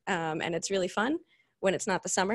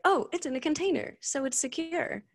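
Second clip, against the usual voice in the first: high, sing-song mock of a clueless claim; high mocking parody of a smug engineer; mocking naive quote; 8 semitones higher than usual. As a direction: parrot the silly line in a high mocking voice. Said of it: "hearing things like".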